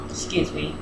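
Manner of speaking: talking past braces; words round a rubbing brace hook. Real, not acted. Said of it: "hook and my braces is rubbing".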